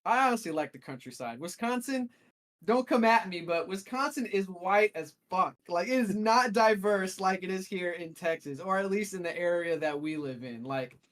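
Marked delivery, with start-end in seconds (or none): 1.4-7.7 excited exclaim. Real, not acted. Joking provocation about Wisconsin.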